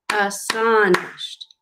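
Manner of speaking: Astonished slowly split as as-TON-ished; clapped out by syllables. Shown as a demonstration. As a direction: clap each syllable of Astonished. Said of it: "Let's clap it out".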